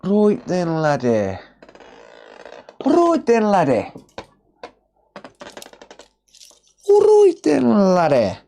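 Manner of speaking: rising Dudley accent greeting. Shown as a demonstration. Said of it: "that's a Dudley accent".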